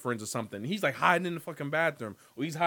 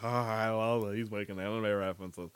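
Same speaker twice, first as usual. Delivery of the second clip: low, soft nerd voice imitating fans; lightly voiced smug anime nerd; mock nerd; 7 semitones lower, 4 dB quieter than usual. Mocking anime fans on the podcast, low and soft. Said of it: "which I know nerds out there like".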